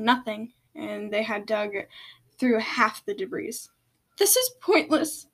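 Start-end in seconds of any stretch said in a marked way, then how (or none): 4.2-5.3 high-pitched frustrated outburst; shouted. A narrator's reading. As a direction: cry it out, exasperated. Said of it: "Seashell shouted".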